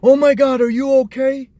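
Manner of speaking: high, loud, fussing panic; mock a frantic visitor gushing over the patient; panicky visitor. Mocking a frantic hospital visitor, high, loud and fussing. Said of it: "someone else being".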